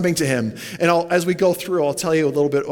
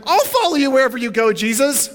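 eager promise; 9 semitones higher, 3 dB louder than usual. Pretend. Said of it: "imagine this morning you're like".

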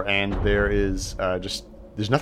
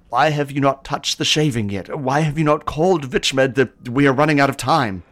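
high, loud and urgent questioning; panicked seer; 7 semitones higher, 8 dB louder than usual. Acted. Seer urging the party over comms, panicked. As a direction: press them hard, time running out.

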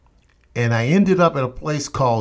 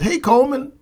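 high, bright-pitched greeting from the smiling sergeant; friendly sergeant; 13 semitones higher, faster than usual. Retold or imitated. Recruiter greets Coleman at the bench, friendly and high. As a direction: greet him like an old friend.

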